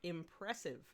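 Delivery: usual speaking voice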